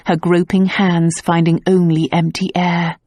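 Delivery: normal talking voice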